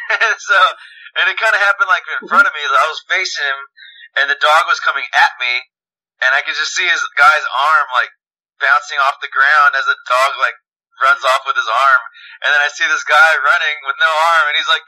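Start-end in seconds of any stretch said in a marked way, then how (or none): none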